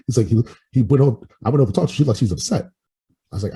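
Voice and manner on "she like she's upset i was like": ordinary talk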